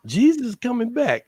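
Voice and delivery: high, earnest, a little preachy; earnest Carl. Retold or imitated. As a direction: plead it like it's obvious. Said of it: "And he goes".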